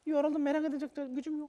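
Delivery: exhausted. Real, not acted. Exhausted, defeated guest.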